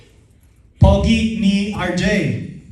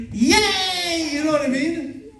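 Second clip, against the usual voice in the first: high mock-baby cheer; talking newborn; 9 semitones higher, slower than usual. Baby coming out of the womb, high mock cheer. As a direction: squeal it out as a comic newborn. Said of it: "baby talking confidently".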